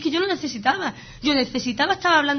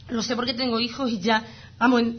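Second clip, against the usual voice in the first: ordinary talk, nothing marked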